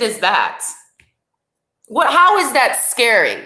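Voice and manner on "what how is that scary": normal talking voice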